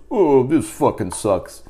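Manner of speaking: teacher mockingly repeating the grumble aloud, slow; mocking teacher voice, slow and drawn out; mocking echo. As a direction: throw the kid's words back at him. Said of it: "And he goes".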